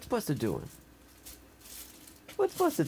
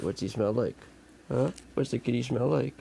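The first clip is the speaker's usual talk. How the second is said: ordinary delivery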